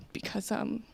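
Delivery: soft, under-the-breath mock sincerity; hushed offer. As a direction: drop to a confidential near-whisper. Server's desperate offer, hushed.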